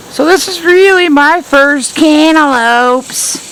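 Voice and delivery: high, slow, delighted showing-off voice; proud sing-song. Real, not acted. Gardener showing viewers her first cantaloupes, high and delighted. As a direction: sing it out like a proud little brag.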